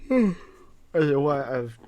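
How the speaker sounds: words stretched through a yawn; yawning while talking. Real, not acted. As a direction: talk mid-yawn, sleepy. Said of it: "why I'm yawning so much today".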